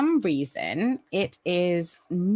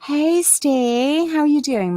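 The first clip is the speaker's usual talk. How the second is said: cheery greeting; 9 semitones higher, 5 dB louder than usual. Real, not acted. Greeting a chat viewer.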